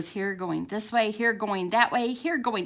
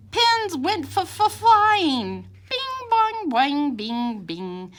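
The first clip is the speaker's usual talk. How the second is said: bursting pins; 8 semitones higher, 4 dB louder, slower than usual. A narrator's reading. Pins flying loose.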